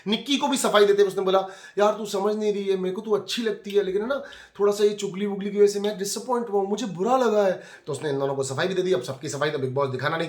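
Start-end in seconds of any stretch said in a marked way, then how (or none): 1.8-7.6 a light impression of a housemate's excuse; explaining himself. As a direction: play the hurt friend explaining himself.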